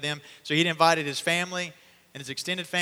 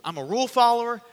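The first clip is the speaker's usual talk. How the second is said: loud insistence; 7 semitones higher, 7 dB louder than usual. Acted. Peter insisting on the rules.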